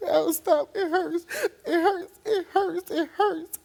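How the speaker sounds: high, loud, tearful crying; child crying that it hurts; crying plea. Retold or imitated. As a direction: sob it out, begging it to stop. Child's cries retold.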